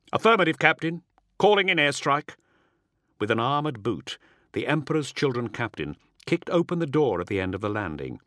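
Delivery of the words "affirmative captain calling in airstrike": high, raised combat reply; soldier's raised radio reply; sergeant acknowledging orders. A narrator's reading. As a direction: confirm the order crisply to your captain. Sergeant answers captain in battle.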